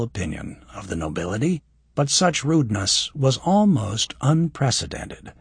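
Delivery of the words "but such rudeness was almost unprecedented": ordinary delivery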